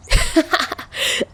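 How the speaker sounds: high, breathy burst of laughter. Acted. Tachihara laughing at Chuya's red face. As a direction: laugh at the flustered friend. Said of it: "Tachihara laughed".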